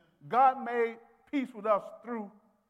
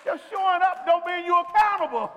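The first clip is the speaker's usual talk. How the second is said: stern rebuke; 8 semitones higher, 8 dB louder than usual. Real, not acted. Preacher rebukes, loud.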